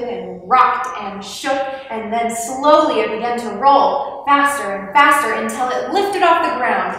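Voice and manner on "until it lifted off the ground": ordinary delivery, nothing marked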